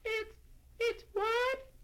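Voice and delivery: very high, puzzled tiny voice; confused snowflake voice. A narrator's reading. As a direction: squeak it out, baffled. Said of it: "not quite understanding".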